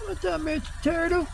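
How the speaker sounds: high, soft pet-talk greeting; high soft hello to a snapping turtle; talking to an animal. Real, not acted.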